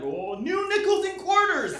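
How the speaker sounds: high, slow, dazzled mock voice; mock dazzled leaders. Politicians dangling shiny coins, in a high, slow, dazzled voice. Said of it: "They're like".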